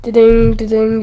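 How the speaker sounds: sung-out "da-ding" chime; imitated quiz bell. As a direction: mimic a right-answer bell. Imitates quiz correct-answer bell.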